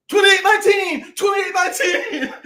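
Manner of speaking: shouted out high and loud across the store; loud call. Retold or imitated. Husband shouting prank code at wife in store, loud. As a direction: call it out loud so everyone hears. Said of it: "I said real loud".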